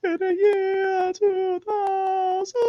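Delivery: very high, slow, quavering falsetto; the Conan skit host chanting about the future. Retold or imitated. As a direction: sing out the future like a spooky prophet. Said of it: "they had this guy go".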